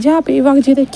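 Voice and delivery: usual speaking voice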